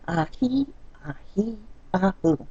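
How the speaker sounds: soft, breathy in-and-out to calm herself; audible calming breaths. Real, not acted. Wife calming herself in the car, audible soft breaths. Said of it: "where you can hear me breathe".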